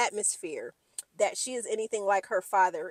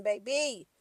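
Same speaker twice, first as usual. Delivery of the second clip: usual speaking voice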